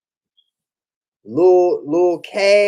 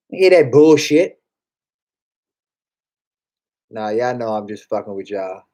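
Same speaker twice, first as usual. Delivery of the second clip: usual speaking voice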